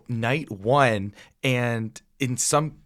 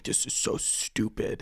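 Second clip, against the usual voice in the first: slow, softer mock disgust; dismissive dad; 8 dB quieter, slower than usual. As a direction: imitate a dad scoffing under his breath. Retells dad scoffing, walking away.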